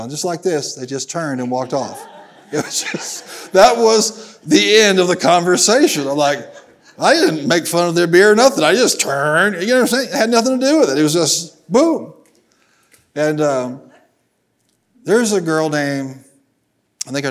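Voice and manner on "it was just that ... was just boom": mock outrage. Stunned at friends walking off.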